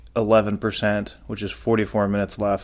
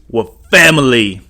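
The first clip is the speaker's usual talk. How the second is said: emphatic plea; 5 semitones higher, 8 dB louder than usual. Real, not acted. Urging fans not to pay scalpers.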